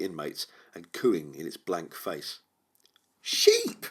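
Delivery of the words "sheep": high, loud cry of triumph; a triumphant, much higher call of "Sheep!". Acted. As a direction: crow it like you won. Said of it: "she said triumphantly".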